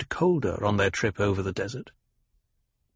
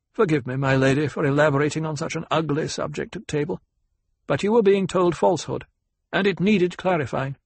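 pitched-up, formal apology; courteous ecologist; 5 semitones higher than usual. Acted. Ecologist begs the lady's pardon at table, courteous and firm. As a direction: apologize politely but hold your point.

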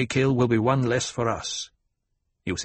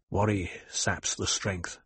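softer, hushed reminder; murmured; 3 semitones lower, 7 dB quieter than usual. A narrator's reading. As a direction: say it low and quiet to an old friend. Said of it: "Paul murmured".

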